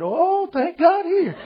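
mock relief. Preacher acting his joy at the policeman's wallet, laughing mock relief. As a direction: savour it like a hammed-up answered prayer.

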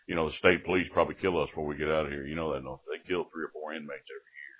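put-on old inmate. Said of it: "he was one of them old".

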